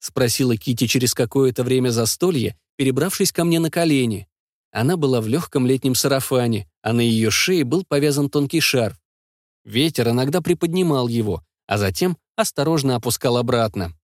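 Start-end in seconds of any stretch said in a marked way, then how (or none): none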